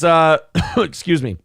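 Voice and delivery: coughing fit mid-sentence; choking on spit, coughing. Real, not acted. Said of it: "I'm choking on my spit".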